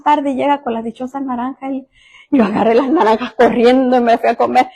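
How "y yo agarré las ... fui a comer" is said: normal talking voice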